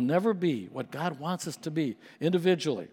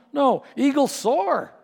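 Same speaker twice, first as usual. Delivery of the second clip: soaring preacher's peak; 8 semitones higher, 4 dB louder, slower than usual. Acted.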